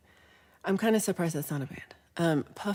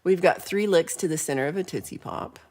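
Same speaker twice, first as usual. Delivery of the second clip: ordinary talk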